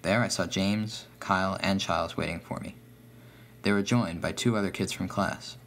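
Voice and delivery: ordinary delivery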